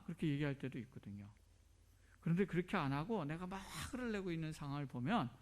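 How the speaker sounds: normal talking voice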